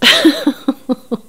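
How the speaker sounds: loud real laugh. Real, not acted. Laughing at Cody's lying to viewers, loud real laughter. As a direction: burst out laughing again.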